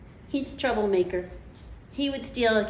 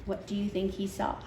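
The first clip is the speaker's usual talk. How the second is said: plain reading voice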